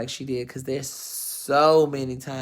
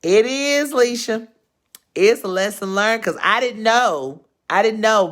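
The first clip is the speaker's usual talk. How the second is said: higher and louder, pressing the point; loud, earnest exclamation; emphatic outburst; 7 semitones higher, 6 dB louder than usual. Real, not acted. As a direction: insist to Leisha that she truly didn't know. Emphatic outburst to friend Leisha.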